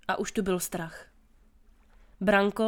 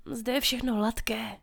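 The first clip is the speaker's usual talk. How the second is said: understated sadness, a little softer; sadly; 4 dB quieter than usual. A narrator's reading. Branko tells the girl the wall is smooth, sadly. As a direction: report it with quiet disappointment. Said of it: "sděloval jí Branko smutně".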